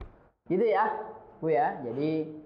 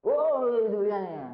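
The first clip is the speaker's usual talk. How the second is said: beach-style shouting; 2 semitones higher, 4 dB louder, slower than usual. Acted. Sulawesi man used to shouting at the beach, mock holler. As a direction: call out as if across a beach. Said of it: "Teriak-teriak gitu".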